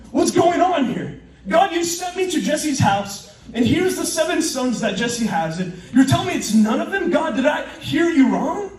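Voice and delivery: acted incredulous complaint; baffled protest. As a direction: plead with God in bewilderment. Acting baffled Samuel questioning God.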